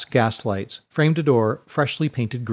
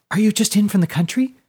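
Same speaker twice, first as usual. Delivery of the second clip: put-on much higher, louder small girl's voice; high breathy girl; 9 semitones higher, 3 dB louder than usual. A narrator's reading. Small girl greeting Fiona, high and eager. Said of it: "high, breathy voice".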